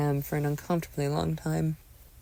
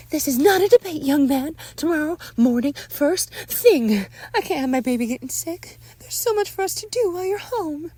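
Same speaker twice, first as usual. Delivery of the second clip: insistent mother; 12 semitones higher, 5 dB louder than usual. Acted. Mother overrules son about doctor visit, high insistent voice. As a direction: shut down the argument, pitched well up.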